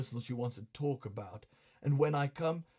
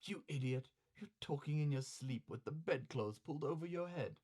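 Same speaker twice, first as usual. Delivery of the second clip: quiet, teasing courtesan; soft scolding tease; soft woman's voice; 9 dB quieter than usual. Acted. Osame scolding softly.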